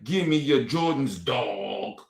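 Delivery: mock a phony street-talk line, dropping low at the end; lightly put-on bozo street voice; mocking imitation. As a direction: scornfully mimic someone faking street slang. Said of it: "Nobody talk like that".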